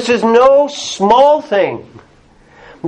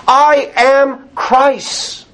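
very slow, "I am Christ's" with a falling close; stress on Christ's; 3 semitones higher, much slower than usual. Shown as a demonstration. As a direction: spell out "I am Christ's" word by word.

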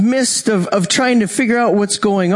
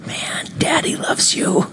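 high soft whisper; 8 semitones higher, 4 dB quieter than usual. Acted. Jesus telling the woman the Father loves her, high whisper. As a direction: breathe it tenderly, barely voiced.

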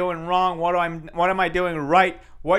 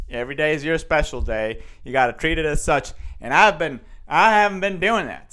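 normal talking voice

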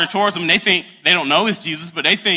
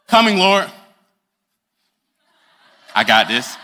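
a hint of strut, louder and cocky; swaggering pimp strut; 2 semitones higher, 4 dB louder than usual. Peter strutting onto the water toward Jesus, cocky swagger. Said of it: "This is my pimp walk".

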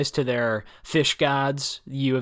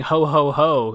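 a jolly, louder ho ho ho, lightly voiced; lightly imitating a cheerful old grandfather; grandfather's ho ho ho; 5 dB louder, slower than usual. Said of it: "he finishes many things by saying".

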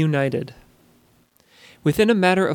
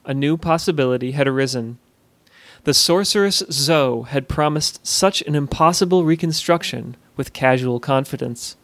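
ordinary delivery

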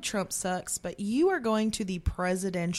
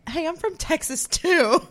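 higher, louder, slow mock Texas twang; mock Texan plea; 9 semitones higher, 7 dB louder than usual. Telling podcast hosts about the protest, a mock Texan drawl. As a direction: drawl it like a friendly fellow Texan.

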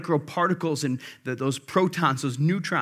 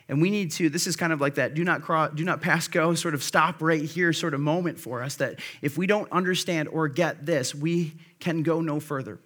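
normal talking voice

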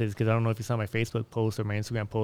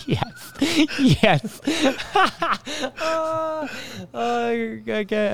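laughing; 13 semitones higher, much slower than usual. Real, not acted. Laughing at the pickup line.

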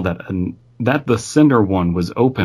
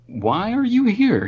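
voiced much higher, puzzled; high-pitched question; 13 semitones higher than usual. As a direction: ask in a squeaky surprised voice. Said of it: "I said".

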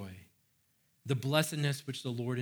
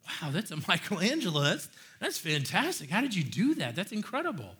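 enthusiastic praise; 4 semitones higher than usual. Retold or imitated. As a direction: marvel at the work. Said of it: "It's spoken with enthusiasm".

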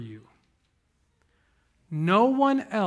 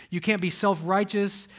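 ordinary delivery

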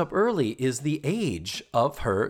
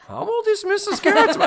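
much higher, squeaky mock question; imitating a friend; 6 dB louder, faster than usual. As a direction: parody her puzzled question. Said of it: "and you even said".

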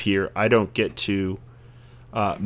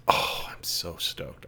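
hushed imitated dad; 5 semitones lower, 9 dB quieter, slower than usual. Dad excited about the book.

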